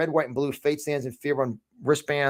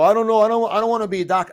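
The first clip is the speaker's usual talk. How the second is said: a light, higher, louder mock of a flustered pundit; mocking stammer; 7 semitones higher, 5 dB louder, faster than usual. Host mocking Fox pundits on air, higher mock stammer. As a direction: ham up a dithering TV guest, stumbling. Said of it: "And people go on Fox, go".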